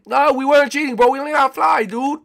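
high, loud put-on protest; indignant rival denial. Other players deny cheating, high mock indignation.

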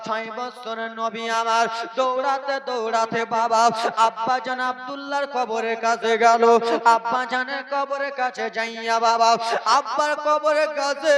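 loud, wailing sermon chant; impassioned preaching. Acted. Preaching at full force. (উচ্চস্বরে আবেগী ওয়াজ)

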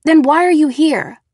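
loud, high, demanding question; sharp demand. Acted. Girl confronts Nate, loud demand. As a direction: demand an answer, loud and insistent. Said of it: "I demanded".

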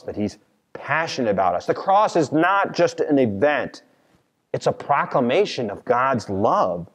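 normal talking voice

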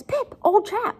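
high-pitched alarmed appeal; Joe freaking out. Acted. Teacher voices Joe alarmed at Pip, high and anxious. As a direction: voice leaps up in mock alarm. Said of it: "he starts freaking out".

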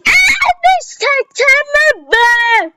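high, stretched-out mock wail; fake crying. Gamer pretending to cry after dying in the game. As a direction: ham up a sob over the lost progress. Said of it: "Şaka şaka ağlamıyorum".

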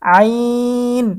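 আইন from a pressed throat, long held ee; three-alif stretch. Shown as a demonstration. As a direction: squeeze the throat, then stretch the ee of আইন evenly. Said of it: "তিন আলিফ টান হবে". (গলায় চাপ দিয়ে আইন টেনে)

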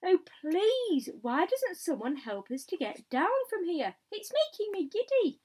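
high, loud, giddy plea; pleading call. A narrator's reading. Miss Spider begging for help getting down, pleading call. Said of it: "Miss Spider called out".

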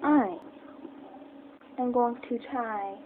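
usual speaking voice

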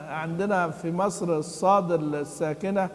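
ordinary talk

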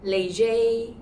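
léger said lay-zhay; wrong take léger as lay-zhay; mispronounced léger. Shown as a demonstration. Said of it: "most mispronounced sounds".

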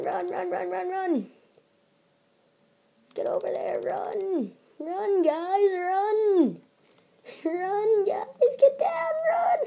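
high frantic urging, rising; panicked. Real, not acted. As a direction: yell run in rising panic. Units under attack, panicking.

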